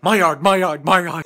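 loud, high yelling "my yard"; shouting dog voice. Retold or imitated. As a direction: bark it like a film dog. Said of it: "he runs out like".

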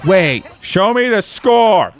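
drawn-out demand to see results; urgent demand. Real, not acted. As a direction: insist on seeing the score. Demanding the score after a match.

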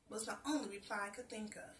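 ordinary delivery

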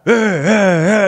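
loud, high mock roaring; toddler attack roar. Dad imitating Caden charging the tower, loud monster-toddler roar. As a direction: roar like a little destroyer. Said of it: "and he'll go".

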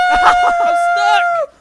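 very loud, high laughing shriek; excited yell. Real, not acted. Player telling friends of stuck car, high laughing yell. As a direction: scream it out, half laughing.

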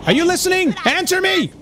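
angry mother. Acted. Voicing the angry game mother.

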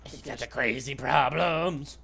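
slow, drawn-out silly voice; hammed-up, dragged-out mockery; mock crazy voice. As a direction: stretch it out as a goofy crazy person. Mocks other player's crazy problems.

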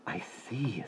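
soft breathy whisper. Acted. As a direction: breathe the words out. Carla reacts softly.

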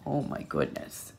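ordinary delivery, nothing marked

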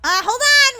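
loud, high brush-off; exasperated call. Acted. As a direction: call back sharply to hold them off. Snapping at impatient customer.

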